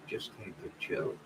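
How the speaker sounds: breathy whispered repeat; hushed whispered echo. Real, not acted. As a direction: whisper it to yourself.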